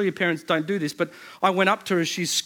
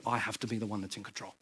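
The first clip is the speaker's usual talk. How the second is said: quiet inner voice; 8 semitones lower, 12 dB quieter, faster than usual. Retold or imitated. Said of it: "that says".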